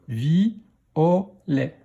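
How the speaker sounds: "vi, o, lait" as three separate beats; syllable by syllable. Shown as a demonstration. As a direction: sound out "vi, o, lait". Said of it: "dans quelle syllabe".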